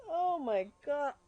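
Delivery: slow stunned disbelief into delight; dawning joy. Real, not acted. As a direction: let the luck sink in. Realising a huge lucky pick.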